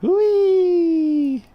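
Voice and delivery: high, loud drawn-out squeal of fun; loud playful wheee; gleeful whee. Pretend. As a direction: squeal like a kid on a slide. Playfully zooming the camera.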